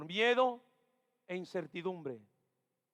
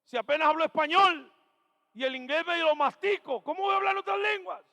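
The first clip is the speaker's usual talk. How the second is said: loud, high, fast mock protest; exasperated outcry; 9 semitones higher, 9 dB louder than usual. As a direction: throw up your hands in disbelief. Retelling his own doubt.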